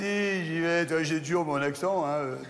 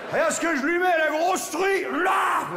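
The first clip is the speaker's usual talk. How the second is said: high, drawn-out shout to the heavens; triumphant cry; 11 semitones higher than usual. Acted. Calling to his dead friend Maurice, triumphant shout.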